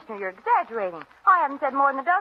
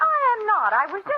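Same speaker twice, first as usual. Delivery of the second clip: high, loud protest; indignant denial; 10 semitones higher, 4 dB louder than usual. Acted. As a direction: object hotly, cutting in.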